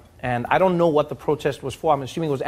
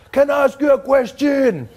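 much higher, loud, slow mock deference through laughter; mock humble grandfather; 11 semitones higher, 5 dB louder than usual. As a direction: play the cheeky polite old man, drawn out. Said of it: "he's like".

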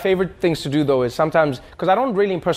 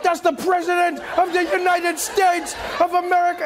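high-pitched, urgent crowd warning; mock alarmed exclamation; 13 semitones higher than usual. Onlookers warning him who he bumped into, high mock alarm. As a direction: imitate appalled onlookers crying out in a high voice.

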